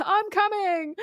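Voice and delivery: high, drawn-out hammy cry; mock rescue call. Host acting the man running over, mock cry. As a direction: call ahead like a comic rescuer.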